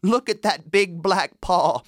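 put-on high kid goat voices, scolding; high children's voices refusing the wolf. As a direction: sound like bold little kids. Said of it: "one of the goats said".

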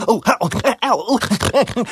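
high pained ooh and ow cries. Acted. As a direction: yelp as the blows land. Groundhog being hit.

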